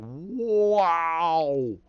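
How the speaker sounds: long, loud drawn-out wow; amazed wow. Retold or imitated. Reacting to the twisted ending, amazed. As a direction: stretch it out in stunned amazement. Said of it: "You're just like".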